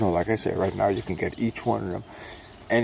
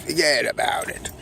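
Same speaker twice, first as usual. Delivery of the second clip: higher, punchy wiseguy take; high Brooklyn catchphrase; 7 semitones higher than usual. Acted. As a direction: toss it off high like a Brooklyn guy. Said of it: "I'm gonna say".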